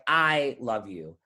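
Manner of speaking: I held and stressed, LOVE YOU falling away; emphasis on I, the rest let drop. Shown as a demonstration. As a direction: lean on the word I. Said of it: "underline words that you want to emphasize".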